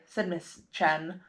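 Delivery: ordinary delivery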